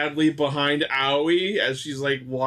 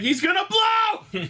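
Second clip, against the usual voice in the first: much higher, a yelled warning; mock alarmed shout; slower than usual. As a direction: shout it like the thing is about to explode. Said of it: "I was like".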